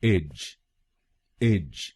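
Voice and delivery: इज said as ij, not एज; corrected syllable. Shown as a demonstration. Teacher corrects students from ej to ij. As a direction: say इज with an i sound. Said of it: "इसको बोलिएगा".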